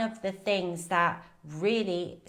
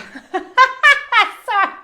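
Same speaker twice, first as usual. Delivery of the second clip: a sudden burst of loud laughter; 14 dB louder than usual. Real, not acted. Laughs at own burping.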